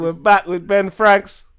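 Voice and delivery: loud, slow "we're back" call; excited announcement. Real, not acted. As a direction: announce the comeback with a big voice. Announcing the guest has returned.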